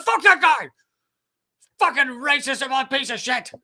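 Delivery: high, loud mocking rant; hammed-up furious yell; mock outrage. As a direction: shout it like an outraged partisan. Imitating angry liberals.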